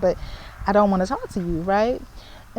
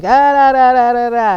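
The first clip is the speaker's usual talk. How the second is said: loud, higher jabbering mimicry; mocking imitation; 4 semitones higher, 12 dB louder than usual. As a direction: imitate his noisy rant in loud nonsense syllables. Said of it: "And he's like".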